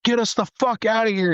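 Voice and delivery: high-pitched frantic yell; retold yell at driver. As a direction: urge the driver on in a panic. Yelling at driver while stuck.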